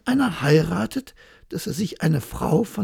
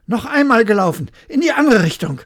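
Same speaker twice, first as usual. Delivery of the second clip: loud, high call for a rematch; loud demand; 5 semitones higher, 9 dB louder, faster than usual. Acted. Hare demanding a rematch from the hedgehog, loud and high. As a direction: insist on running again.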